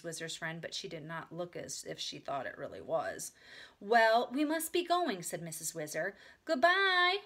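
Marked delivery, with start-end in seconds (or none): 3.8-5.2 louder, higher leave-taking; breezy grown-up lady. A narrator's reading. Missus Whizzer ends the chat, breezy and loud. 6.4-7.2 high, loud, courteous farewell; polite goodbye. A narrator's reading. Beezus takes leave of Missus Whizzer, high and polite. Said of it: "Beezus politely".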